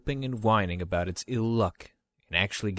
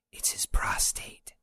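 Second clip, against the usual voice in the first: breathy unvoiced whisper, slowed down; 4 dB quieter, slower than usual. A narrator's reading. Yuki whispering a secret to the two men. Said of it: "she whispered".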